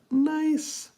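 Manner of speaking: very high, drawn-out falsetto; falsetto approval. Real, not acted. Streamer approving a viewer's kissing joke, high falsetto. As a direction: squeak it out high and long.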